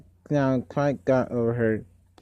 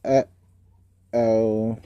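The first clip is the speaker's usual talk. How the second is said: mock dismayed uh-oh; 3 semitones lower than usual. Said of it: "Both children said".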